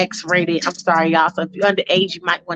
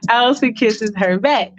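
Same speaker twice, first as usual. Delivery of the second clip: ordinary talk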